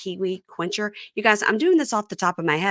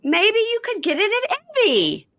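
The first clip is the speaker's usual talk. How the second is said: mock sweet voice; 3 dB louder than usual. Joking reply about Envy.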